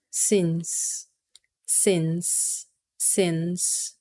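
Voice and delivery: since said slow and soft, crisp s at both ends; careful, soft model of since, repeated; pronunciation of since. Shown as a demonstration. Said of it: "a pronúncia, eu coloquei aqui". (pronúncia modelo de since, devagar e suave)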